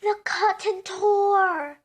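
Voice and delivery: slow, loud, high announcement; dramatic climax. A narrator's reading. Storyteller to children at the climax, slow and dramatic. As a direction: land it slowly like a thunderclap.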